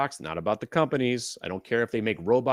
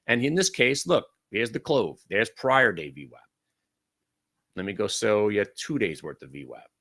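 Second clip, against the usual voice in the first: usual speaking voice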